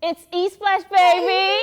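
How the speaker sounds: high, loud excited shout; big hyped-up opener; hyped show intro. Real, not acted. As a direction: shout the show's name to kick things off.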